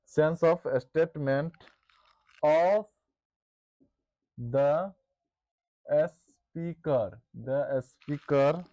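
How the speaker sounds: slow, word-by-word dictation pace. Shown as a demonstration. Teacher dictating a definition to the class, slow and deliberate. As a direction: dictate each word slowly so students can write. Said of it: "teacher dictating definition for notes".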